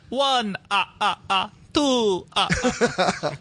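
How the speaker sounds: lightly hammed halting Eastern European accent; mocking her accent. Podcaster mocking Scarlet Witch's Eastern European accent, halting and hammed. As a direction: stammer through the numbers in a mock Eastern European accent. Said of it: "she was like".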